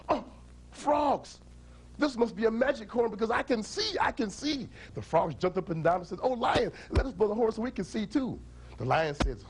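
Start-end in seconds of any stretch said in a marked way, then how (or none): 0.1-4.7 high-pitched joyful lion; amazed excitement. Acted. Blind lion can suddenly see. 6.2-8.4 high-pitched eager frogs; excited pleading. Acted. Frogs beg for their turn.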